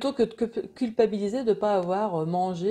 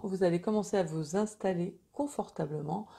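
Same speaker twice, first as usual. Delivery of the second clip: guided meditation; 5 dB quieter than usual. Acted. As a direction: guide them gently into calm.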